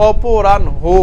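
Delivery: অপরাহ্ন said slowly as "o-po-ran-ho", ending in a separate "ho"; teacher stressing the "ho" that ends অপরাহ্ন; correct pronunciation. Shown as a demonstration. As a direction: model অপরাহ্ন with the final "ho" set apart. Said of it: "উচ্চারণটা কীরকম হচ্ছে".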